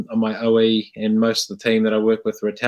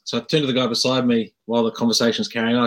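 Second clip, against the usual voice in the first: ordinary talk, nothing marked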